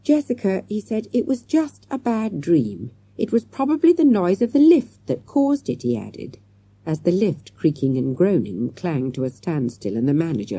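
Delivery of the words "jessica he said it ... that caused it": tired, patient grown-up voice; weary teacher. Acted.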